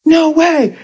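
high, stunned disbelief; mock dismay at a friend's cancer; shocked dismay. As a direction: gasp it out like bad news just hit. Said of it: "And you go".

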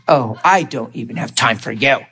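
low, softened mock disgust; disgusted nurse. Nurse brushing off a patient, imitated disgust. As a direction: dismiss them with quiet acted disgust. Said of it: "she was so disgusted".

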